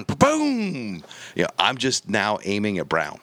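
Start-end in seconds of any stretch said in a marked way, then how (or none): 0.0-1.0 gunshot boom. Retold or imitated. Hunter mimicking his rifle firing at the deer, high boom. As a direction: mimic the crack of a thirty thirty.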